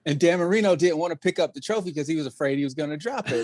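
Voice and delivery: ordinary talk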